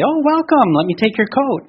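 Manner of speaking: a high, delighted "Oh, welcome" to a guest; bright welcome. Retold or imitated. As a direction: greet the guest at the door with real delight. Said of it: "I would say".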